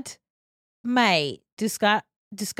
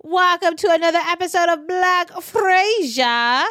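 podcast host intro; 8 semitones higher, 5 dB louder than usual. Acted.

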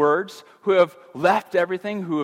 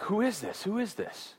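hushed, much softer awed question; awed disciples; 3 semitones higher, 9 dB quieter than usual. Retold or imitated. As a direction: murmur it in stunned wonder. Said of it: "They're like".

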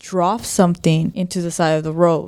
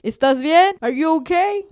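higher, rising call to a stranger; worried call in both languages; 9 semitones higher than usual. Retold or imitated. Father calling to the bag on a dark roadside, high and cautious. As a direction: check on the twitching bag in Spanish then English. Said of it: "Like, in both languages".

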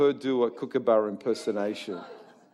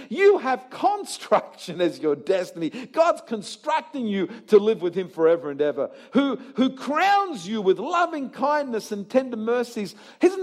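high, energetic pulpit proclamation; rousing preaching; 7 semitones higher, 4 dB louder than usual. Real, not acted. Preacher urging congregation to believe the promises, rousing. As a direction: drive each promise home to the crowd.

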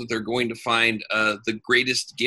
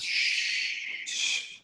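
unvoiced shush; 8 dB quieter than usual. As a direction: hush him softly. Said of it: "just says".

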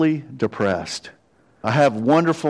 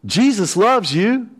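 calling out cheerfully, higher; a passing runner's bright greeting; cousin's call while jogging; 9 semitones higher, 3 dB louder, slower than usual. Retold or imitated. Cousin shouting to strangers while jogging, cheery call. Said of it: "he'll go".

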